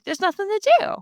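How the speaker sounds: high squeaky mocking echo; mocking squeak. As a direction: parrot it back high and silly. Echoing a friend in a squeaky mock voice.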